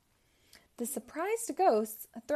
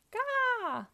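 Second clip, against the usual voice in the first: a tiny high exhausted gasp; worn-out baby gasp; weak gasp; 14 semitones higher than usual. Acted. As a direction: gasp like you've run out of air. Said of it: "gasped baby Pac-Man weakly".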